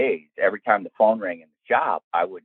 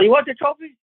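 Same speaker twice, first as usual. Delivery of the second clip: high pointed question; high mock-stern question from Tina; wife on the phone; 5 dB louder than usual. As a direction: mimic her blunt offer. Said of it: "she's all".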